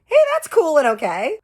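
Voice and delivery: high, loud mocking sarcastic voice; mock approval. She mocks an imagined girlfriend's fake approval, high and loud. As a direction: mimic a woman faking being fine with it.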